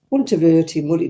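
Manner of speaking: ordinary talk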